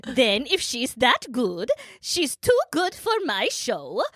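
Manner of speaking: put-on hammy Swedish-chef-style Norwegian accent; lilting mock accent, laughing through it. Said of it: "we're laughing 'cause of my, how I'm saying it".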